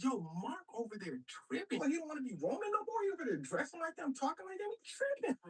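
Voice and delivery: quick complaint climbing very high; mock outrage. Octavius stirring up Rome against Mark, mock outrage. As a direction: build to an incredulous squeal.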